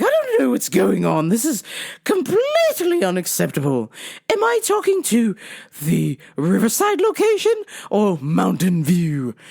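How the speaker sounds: indignant mock-English customer; phony English accent. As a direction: demand answers in fake English. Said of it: "phony English accent".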